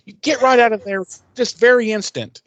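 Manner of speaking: high-pitched, prissy scolding voice; scolding Wes. Retold or imitated. Wes scolds Cordelia to climb out of the vat, in a high prissy voice. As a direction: play up a fussy schoolmarm telling her off. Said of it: "scalding Cordelia".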